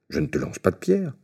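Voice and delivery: mumbled, lightly voiced grumpy denial; grumbling giant. A narrator's reading. Giant denies throwing stones, mild grumble. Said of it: "répondit le premier en bougonnant".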